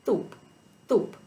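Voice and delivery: "toop" said with a frontal lisp for soup. Shown as a demonstration. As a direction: say "toop" with the tongue pushed forward. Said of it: "So soup will sound like".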